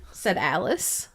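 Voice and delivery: usual speaking voice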